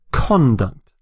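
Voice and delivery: "conduct" stressed on the first syllable, CON-duct; noun stress. Shown as a demonstration. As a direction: hit CON in "conduct" with a full vowel. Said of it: "if it's a noun".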